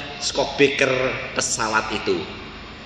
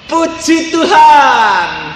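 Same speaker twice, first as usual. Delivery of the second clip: drawn-out praise; 6 semitones higher, 4 dB louder, much slower than usual. Retold or imitated. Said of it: "Harold Hill malah bilang apa?".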